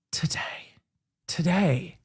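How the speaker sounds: whispered. Real, not acted. Sighing aside.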